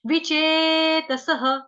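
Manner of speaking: विचेतसः drawn out, final ह breath; slow pronunciation. Shown as a demonstration. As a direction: sing विचेतसः slowly, end on ह. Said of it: "उच्चारण समझा देती हूँ". (विचेतसः धीरे, विसर्ग ह के साथ)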